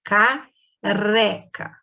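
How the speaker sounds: "Careca" very slowly, ca, then a tongue-trembled reca; drawn-out soft R. Shown as a demonstration. Teacher inviting pupils to try it, "Careca" slow with soft R. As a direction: stretch "Careca" apart to show off the tapped R. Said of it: "Experimenta aí pra você ver".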